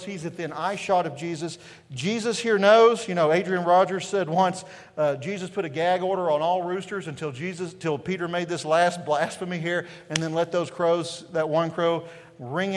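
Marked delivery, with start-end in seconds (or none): none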